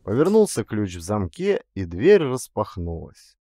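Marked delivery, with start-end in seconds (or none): none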